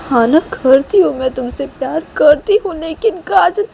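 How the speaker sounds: high, loud anguished voice; torn confession. Acted. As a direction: confess but hesitate. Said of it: "Reshma बोलती कि".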